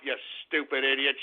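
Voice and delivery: a low put-on voice for the insult; mock growl insult. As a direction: drop into a low mocking voice. Mocking a chat name.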